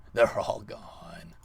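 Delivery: slow, hushed sinister whisper. Real, not acted. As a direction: savor it in a whisper. Gloating after wiping lands.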